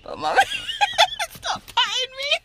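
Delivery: very high squealing laughter; laughing plea. Real, not acted. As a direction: squeal through giggles. Laughing pleading with mom.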